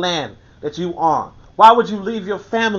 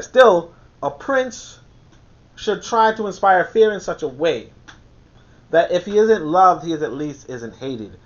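measured, lower reading voice; reading aloud; 6 semitones lower than usual. Acted. Reading The Prince aloud to his audience, measured tone. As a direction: read the passage out steadily.

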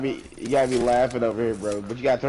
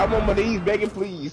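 mocking imitation; 7 semitones higher than usual. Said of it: "He said".

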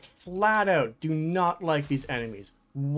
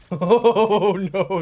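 panicked laugh; 4 semitones higher, 9 dB louder than usual. Real, not acted.

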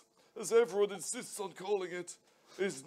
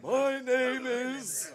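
grand slow announcement; 3 semitones higher, 6 dB louder, slower than usual. Acted. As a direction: stretch it out, slow and proud. Grand self-announcement, slowed.

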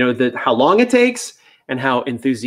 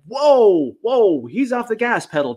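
higher, caught-off-guard whoa that falls away; startled whoa; 9 semitones higher, slower than usual. Retold or imitated. Trainer startled by a dog racing through tricks, surprised. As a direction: pull back in surprise at a dog going too fast.

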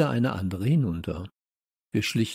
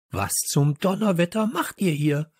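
high-pitched, cross huff; huffing indignation; 6 semitones higher than usual. Acted. Teacher catching pupils in the hideout, huffing indignantly. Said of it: "schnaufte Popo".

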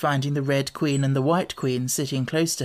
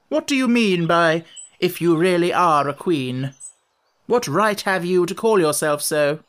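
higher, louder haughty questioning; imperious challenge; 4 semitones higher, 4 dB louder than usual. A narrator's reading. Red Queen challenging Alice's right to the title, imperious. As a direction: lay down the rules imperiously.

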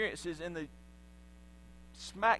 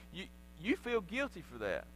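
normal talking voice

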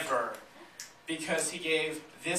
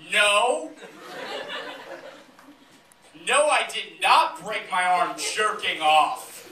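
loud, raised, slow mock outrage; indignant protest; 5 semitones higher, 7 dB louder, slower than usual. Teased student with a broken arm, indignant denial. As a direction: deny it loudly, stretching each word. Acted protest against a teasing accusation.

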